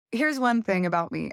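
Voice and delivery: usual speaking voice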